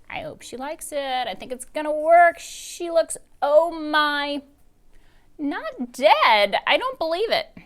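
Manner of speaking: put-on high, loud anxious child; a girl watching her plan fail; Dory voice. A narrator's reading. Dory watches the witch eat, high and anxious.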